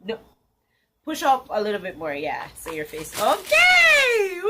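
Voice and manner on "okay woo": high, loud hype-up cheer; big drawn-out cheer; excited whoop. Real, not acted. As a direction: whoop it up to kick off the video. Hyping up the video's start.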